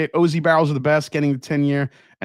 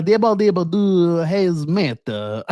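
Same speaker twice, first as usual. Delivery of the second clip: higher, drawn-out mock French gibberish; bad French accent; 5 semitones higher, slower than usual. Shown as a demonstration. Host clowning after failing at it, hammed-up fake French. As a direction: ham up a hopeless French accent, stretching the end. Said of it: "I can't do a French accent".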